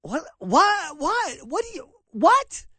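stunned by the replayed quote; baffled. Real, not acted. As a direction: sputter in disbelief. Baffled after the clip.

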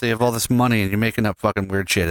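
normal talking voice

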